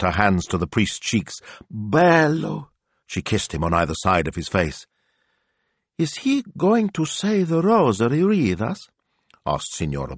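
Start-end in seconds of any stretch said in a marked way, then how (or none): 1.7-2.7 high-pitched adoring exclamation; doting Italian lady; gushing signora. A narrator's reading. Signora gushing over the priest. 6.0-8.9 very high, slow lady's voice; high-pitched Italian churchgoer; curious signora. A narrator's reading. Sister asks in character voice.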